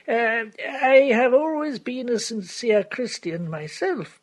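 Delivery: put-on slow, careful old lawyer, fading to a whisper; elderly lawyer. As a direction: weigh each word like a cautious solicitor.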